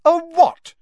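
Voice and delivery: high, loud, furious demand; shouted challenge. Acted. Ginny cuts her brother off, shouting. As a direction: cut in with an outraged shout. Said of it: "shouted Ginny".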